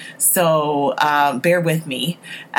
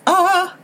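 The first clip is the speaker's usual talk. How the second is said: short, high gasp of delight; excited squeal; 11 semitones higher than usual. Real, not acted. Fan thrilled that a flashback starts, squealing. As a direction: let out a high "ah" of glee.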